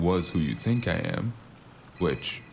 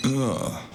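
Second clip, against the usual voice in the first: higher grunt of pain; 8 semitones higher than usual. Acted. Struck again, a pained grunt. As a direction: grunt as it hurts.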